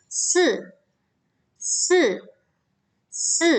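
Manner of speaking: si falling sharply from high; emphatic falling fourth tone. Shown as a demonstration. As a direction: drop si hard from the top. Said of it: "itu empat".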